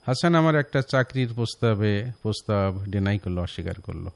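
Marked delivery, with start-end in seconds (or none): none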